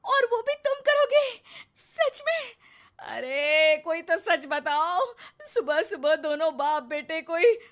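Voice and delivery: very high, loud sarcastic astonishment, slowing on the accusation; mock disbelief. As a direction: ham up the shocked wife. (बहुत ऊँची, ज़ोरदार व्यंग्य भरी हैरानी)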